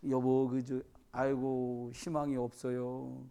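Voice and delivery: slow, low, sighing hopelessness; despairing sigh. Acted. Wife lamenting to husband, slow and hopeless. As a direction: drag out the sigh of giving up. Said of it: "보니까".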